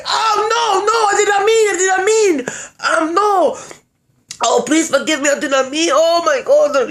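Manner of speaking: high-pitched frantic pleading; mock panic. Man begging her forgiveness, acted panic. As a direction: imitate a man begging in terror, hammed-up. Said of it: "So he get the panicking".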